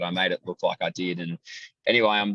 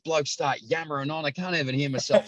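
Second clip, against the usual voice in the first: put-on high, grumbling old bloke; an old spectator grumbling about the noisy commentators, in a high mock voice; older gentleman; 7 semitones higher than usual.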